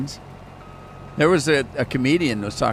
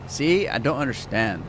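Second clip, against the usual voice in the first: usual speaking voice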